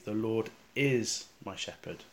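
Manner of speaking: "is" held out and set apart, softly; stress on is. Shown as a demonstration. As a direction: pause on and lengthen "is", then fall away. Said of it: "try emphasizing the word".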